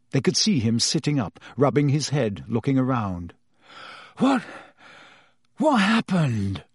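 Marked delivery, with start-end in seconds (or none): 4.2-6.6 groaned. Acted. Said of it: "he groaned".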